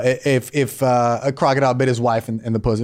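ordinary talk, nothing marked

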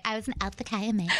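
put-on quick, proud sorority girl. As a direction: rattle it off like a proud sorority sister. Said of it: "the way that you guys say it".

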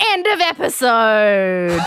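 long drawn-out exclamation sinking in pitch; big mock finale. Real, not acted. Host ending her chaotic recap, triumphant. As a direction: stretch it out like a triumphant sign-off.

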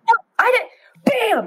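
put-on high, slow childish whine; whiny toddler denial; little kid denying. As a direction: imitate a small child insisting it wasn't them. Kids fighting, voiced by speaker.

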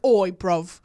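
cockney British call; cartoonish British street greeting; British accent. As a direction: overdo a cockney British lad. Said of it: "I would have a British".

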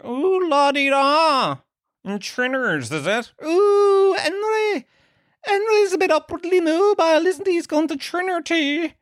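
very high falsetto teasing, stretched oohs; hoity-toity mockery. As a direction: tease with a squealing, hammed-up la-di-da. Mocks Henry as posh, upwardly mobile.

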